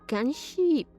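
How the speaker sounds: ordinary talk, nothing marked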